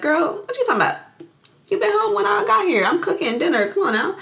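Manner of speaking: high-pitched, incredulous brush-off; mock dismissive husband. Husband to wife about getting home, mock dismissive. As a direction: wave her off in a high voice.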